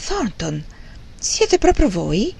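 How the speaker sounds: recognise someone with slow, rising astonishment; can it really be you; surprised recognition. A narrator's reading. Surprise at meeting an acquaintance.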